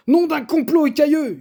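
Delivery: high, loud enraged shout; furious yell. A narrator's reading. Paul yelling at the pangolin, high furious shout. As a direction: roar at the thieving pangolin. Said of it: "hurla-t-il".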